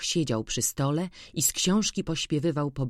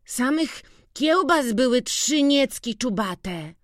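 put-on excited boy describing; 9 semitones higher than usual. A narrator's reading. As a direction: brag about the plentiful feast. Witek describes the feast.

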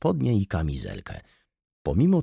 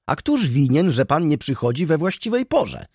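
higher, louder indignant yell; 8 semitones higher, 3 dB louder than usual. A narrator's reading. Student yelling at the steward over the rent, loud. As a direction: bellow the blame back at him. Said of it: "wrzasnął młody człowiek".

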